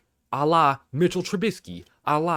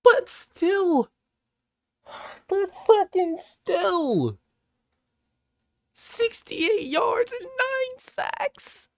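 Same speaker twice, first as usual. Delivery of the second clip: very high, slow, drawn-out disbelief; incredulous wail; much slower than usual. Real, not acted. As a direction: stretch every word like you can't believe it. Exasperated rant over the stats.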